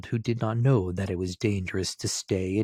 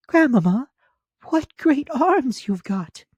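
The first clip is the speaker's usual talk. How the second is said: put-on much higher, louder child voice; little girl voice; 8 dB louder than usual. A narrator's reading. Little girl answering the wolf, high and childlike. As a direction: pipe it up like a small girl. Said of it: "she said to her".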